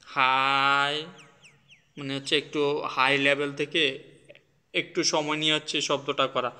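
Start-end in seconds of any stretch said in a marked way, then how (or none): 0.0-1.1 হাই held long and level; long, unwavering হাই; high flat tone. Shown as a demonstration. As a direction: stretch হাই out on one steady note. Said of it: "high and flat".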